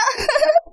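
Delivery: short high giggle. Acted. Girl giggling at what's inside the washing machine. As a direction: break into a soft high giggle. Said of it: "क्यों हंस रहे हैं?".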